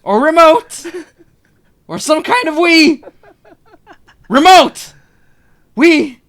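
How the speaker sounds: very high, loud squeak; squeaky exasperation. Real, not acted. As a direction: voice cracking up high in frustration. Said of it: "We broke him".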